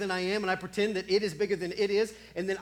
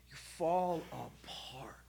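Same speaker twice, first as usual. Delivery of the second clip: slow, hushed breath; whispered; 5 semitones lower, 5 dB quieter, slower than usual. Acted. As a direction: let it collapse into a whisper.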